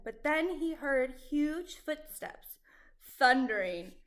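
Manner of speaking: ordinary delivery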